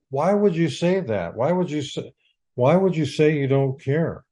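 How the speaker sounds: ordinary talk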